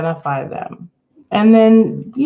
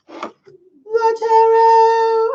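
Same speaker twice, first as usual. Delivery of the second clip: mock mystical; 4 dB louder, much slower than usual. Mock-solemn invocation of the tarot.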